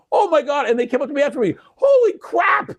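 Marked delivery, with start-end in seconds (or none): 0.1-0.7 gasping surprise. Retold or imitated. Friends gasp in crowd. 1.8-2.7 excited disbelief. Retold or imitated. Friends rushing up after meeting the legend, squealing and shouting.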